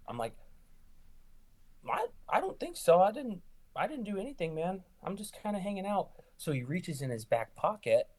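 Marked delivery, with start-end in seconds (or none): 1.9-6.1 high-pitched, stammering protest; mock nervous denial. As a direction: plead innocence in a squeaky, acted voice. Retells placating man with switchblade.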